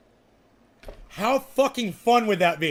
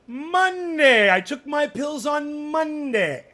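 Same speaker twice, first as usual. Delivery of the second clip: loud, higher reminder to himself, drawn out slowly; 6 semitones higher, 5 dB louder, slower than usual. Shown as a demonstration. Reminding himself about his pills, loud and slow. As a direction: announce it loudly so it sticks in memory. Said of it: "I say it loud so I remember that".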